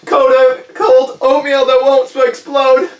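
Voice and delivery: loud, high, slow wail; panicked self-soothing. Acted. Balloon blower clings to happy thoughts, high slow panic. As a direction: drag out each comforting word in dread.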